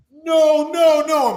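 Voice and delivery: loud, high warning cry; alarmed pleading. Retold or imitated. Wrestlers begging their friend not to fight, alarmed. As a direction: plead with him to stop, voice jumping up. Onlookers try to stop the fight.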